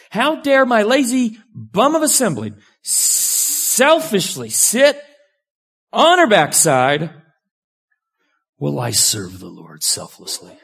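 indignant sister complaining to Jesus, hammed-up self-righteous whine; self-righteous indignation. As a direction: play Martha huffing about her lazy sister. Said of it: "Do you detect a hint of self-righteousness?".

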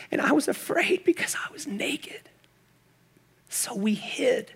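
hushed, halting whispered confession; afraid whisper. Acted. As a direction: whisper it as if hiding from God. Man in hiding confesses fear.

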